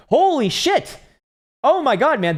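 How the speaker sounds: high, loud, thrilled cry of joy; excited shriek. Real, not acted. Streamer cheering a viewer's big win, squealing with joy.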